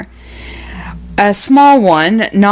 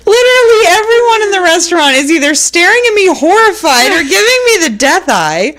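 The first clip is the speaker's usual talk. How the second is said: ordinary talk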